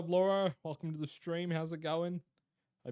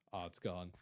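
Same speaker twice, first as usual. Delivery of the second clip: ordinary delivery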